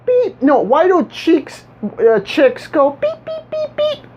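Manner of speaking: high-pitched mimicked beeping; imitated chick beeps. Retelling a classmate's question about chicks, high imitated beeping. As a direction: do tiny, high chirping beeps. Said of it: "I just hear one kid in the class go".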